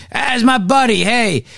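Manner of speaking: high-pitched mock greeting of a pal; hammed-up buddy-buddy imitation; mock chummy. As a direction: imitate a politician gushing over his pal. Mocking candidates embracing the governor.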